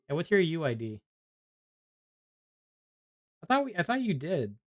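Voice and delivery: ordinary talk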